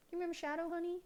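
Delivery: high, soft voice for the cat; pet talk. Real, not acted. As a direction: coo softly to your pet. Talking sweetly to her cat.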